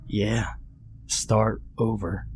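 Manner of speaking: slow and soft; a lightly voiced calm god; quiet calm stranger. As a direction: say it flatly, unbothered. Old man voice.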